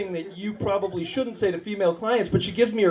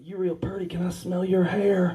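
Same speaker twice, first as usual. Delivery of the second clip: creepy come-on; 6 dB quieter than usual. Retold or imitated. Comedian quoting his creepy come-on to a patient, creepy. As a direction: murmur it like an unsettling admirer. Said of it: "I was freaking her out".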